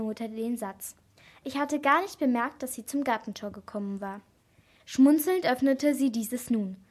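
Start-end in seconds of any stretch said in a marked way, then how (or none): none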